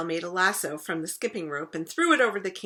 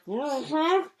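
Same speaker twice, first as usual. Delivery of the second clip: mouth full; 8 semitones higher than usual. Acted. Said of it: "because he was chewing".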